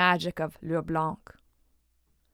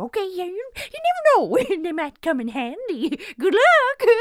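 put-on much higher, louder old crone; cackly old woman; 9 semitones higher, 5 dB louder than usual. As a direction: play a chirpy old lady. Old woman gives directions.

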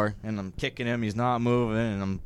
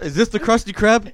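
SpongeBob caller voice; 8 semitones higher, 6 dB louder than usual. Retold or imitated. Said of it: "Bob's Pizza".

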